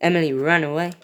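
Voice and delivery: usual speaking voice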